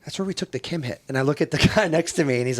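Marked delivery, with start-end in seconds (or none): 0.0-1.0 \"That's where we took the Kim hit\" said much softer, nearly under the breath; quiet aside. Retold or imitated. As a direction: mutter it quietly to the guy beside you. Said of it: "and I'm like".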